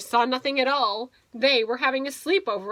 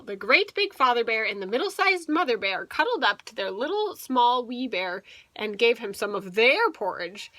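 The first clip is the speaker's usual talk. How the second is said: usual speaking voice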